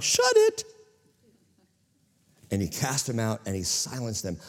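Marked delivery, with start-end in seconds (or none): 0.0-0.6 very high, loud snapped command; Jesus silencing the demons, loud and sharp; stern rebuke. Retold or imitated. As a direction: cut them off sharply. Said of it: "And Jesus was like".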